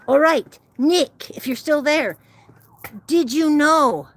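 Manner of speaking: half-shouted call to a missing viewer. Real, not acted. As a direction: call out loudly to a friend who might be gone. Calling to absent viewer Nick.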